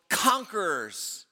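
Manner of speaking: ordinary talk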